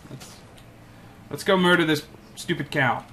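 ordinary delivery

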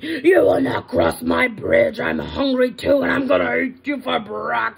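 loud troll tirade, words stretched; screaming troll. Acted. Troll threatens to eat the little goat, loud scream. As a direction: bellow threats like an outraged troll. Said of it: "as he screamed".

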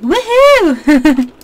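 high, loud playful whoop; imitated woohoo cheer. Player mimics the Sims couple's signature move, playful cheer. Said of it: "They always are like".

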